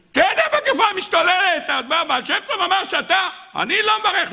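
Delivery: high-pitched exasperated outburst; agitated protest. Acted. Townsfolk and reluctant drinker argue over a blessing, agitated protest.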